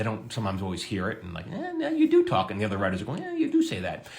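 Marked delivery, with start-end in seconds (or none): 1.5-2.5 high teasing impression of a coworker; high mock voice. Quoting the showrunner's rebuttal. 3.2-4.1 high, teasing agreement; high teasing writers' chorus voice; high mock voice. Quoting the other writers agreeing.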